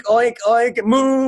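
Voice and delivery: mock the crowd like farm animals; mock animal noises. Angry comic mocking the kid-show crowd with animal noises. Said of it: "put oink".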